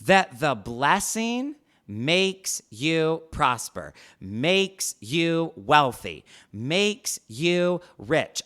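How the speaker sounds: "makes" punched each time, slow and deliberate; stress on makes. Shown as a demonstration. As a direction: lean hard on "makes", slowly. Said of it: "I really want to emphasize is the verb".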